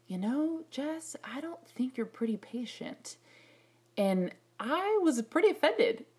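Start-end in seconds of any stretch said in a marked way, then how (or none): 0.1-3.2 friend, gently. Retold or imitated. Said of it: "a friend telling me".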